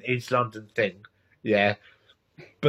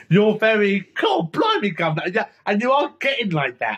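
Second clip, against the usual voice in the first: a hammed-up Cockney voice, high on blimey; mock Cockney; 5 semitones higher than usual. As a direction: tease her by doing her broad Cockney. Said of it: "fuck me, you are a different person".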